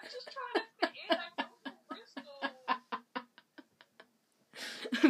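laughing, quick mock-Scottish accent gone astray; attempted Scottish accent. Bristol man trying to fit in, mock-Scottish accent. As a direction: try a Scottish accent, giggling. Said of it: "I'm trying to be Scottish".

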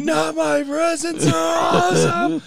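high, slow whining protest; whiny plea. Retold or imitated. Boy pleading with mom not to lose gifts. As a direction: whine to keep the presents.

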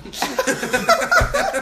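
loud, high-pitched laughter; cracking up. Real, not acted. Group cracks up at crude joke.

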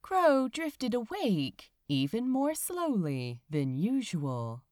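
plain reading voice

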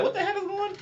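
high, sharp cry of disbelief; shocked disbelief. Retold or imitated. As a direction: blurt it out in total shock. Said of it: "I'm like".

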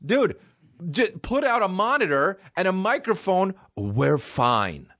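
high, exasperated pleading; exasperated rant. Retold or imitated. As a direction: plead with the venue, fed up. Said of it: "And I'm like".

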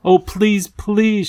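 high, imploring plea; begging. A narrator's reading. Lindy clings to the proc and begs, pleading. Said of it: "she begged".

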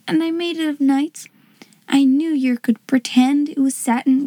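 put-on earnest little Cockney-style girl; shy servant girl, joyful. Servant girl explaining her homemade present, shyly joyful.

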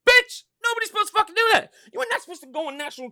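very high, loud, fast yell; outraged shriek. Real, not acted. Blasting the quarterback's excuse, shrieking with outrage. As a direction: shriek it in disbelief.

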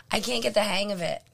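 ordinary talk, nothing marked

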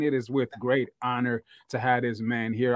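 ordinary talk

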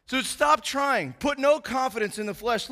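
normal talking voice

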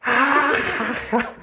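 high mock burst of laughing; imitated classroom laughter. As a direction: mimic a whole class cracking up. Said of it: "el salón entró así". (carcajada imitada del salón)

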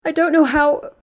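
high, loud sob that cuts off; choked, breathless crying; breaking off, breathless. Acted. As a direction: run out of air mid-line. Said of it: "Ginny broke off as she struggled to breathe".

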